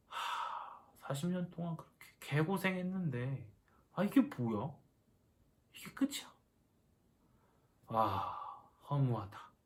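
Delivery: play-acted deflated sigh of disbelief; mock dejection. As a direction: sound let down after years of wasted toil. Imagines Moses dejected at the end. (허탈한 척 한숨 섞어)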